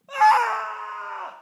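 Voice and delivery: high loud throwing shout, imitated; imitated full yell. Dad imitates son's big shot put yell, high loud shout. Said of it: "and he goes".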